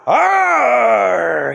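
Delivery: loud growling monster scream; monster roar. Acted. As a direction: roar to scare them. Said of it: "he screamed".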